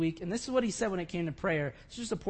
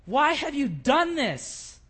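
higher, slow, bewildered question to God; confused plea; 5 semitones higher, 6 dB louder, slower than usual. Retold or imitated. Elijah, confused and brokenhearted, asking God why. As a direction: ask God why, lost and hurt. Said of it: "And he says".

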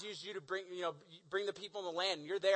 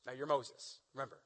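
ordinary talk, nothing marked

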